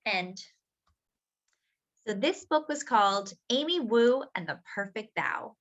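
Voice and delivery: ordinary delivery, nothing marked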